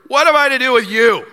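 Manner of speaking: high, loud mock rebuff; indignant rebuke. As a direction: throw it at an unwelcome king. Acts out the prophet's rebuke.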